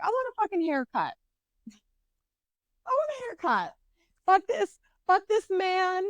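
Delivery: high-pitched mock petulant demand; fed-up demand. Woman replaying her birthday demand to grandmother, fed-up and high-pitched. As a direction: whine it high, acting out your own outburst.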